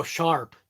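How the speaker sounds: a doubtful careful "sharp", R fully sounded; R pronounced. Shown as a demonstration. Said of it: "Doesn't sound right".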